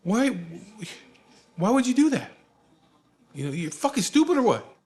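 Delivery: high-pitched incredulous scolding; flipping out. Retold or imitated. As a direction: imitate an elder losing it in disbelief. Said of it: "He flips his shit".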